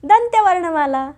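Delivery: दंत्य lifted high, वर्णमाला follows; teacher sums up for children; emphatic conclusion. Shown as a demonstration. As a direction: say दंत्य वर्णमाला as the triumphant answer. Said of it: "म्हणूनच ही आहे".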